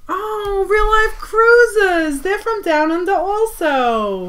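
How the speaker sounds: high, slow delighted squeal of a name; light up and stretch the name out; excited greeting. Real, not acted.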